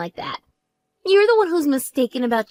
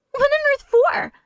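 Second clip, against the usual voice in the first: high, slow outraged disbelief; stunned squeal; slower than usual. Acted. Sister hearing of the secret wedding, outraged disbelief. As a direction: stretch it out in outrage.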